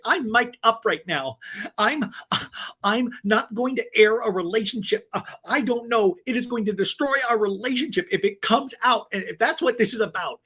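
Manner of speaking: high, indignant mock rant; mock outrage. Cast member leaving the table over a secret, indignant. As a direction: imitate a worked-up storm-off. Retold upset storm-off.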